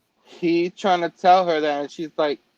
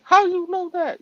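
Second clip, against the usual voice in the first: high, shocked disbelief; shocked question; 13 semitones higher, 4 dB louder than usual. Retold or imitated. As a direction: jump way up in stunned disbelief. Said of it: "she's like".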